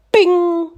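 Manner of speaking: high ringing "bing" sound effect; imitated skillet clang. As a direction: mimic a pan bouncing off a skull. Imitates skillet hitting his head.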